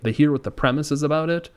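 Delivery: normal talking voice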